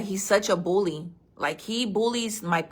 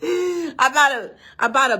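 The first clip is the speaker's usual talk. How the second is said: laughing; 6 semitones higher, 4 dB louder than usual. Real, not acted. Laughing about cayenne pepper.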